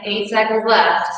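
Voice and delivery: ordinary talk, nothing marked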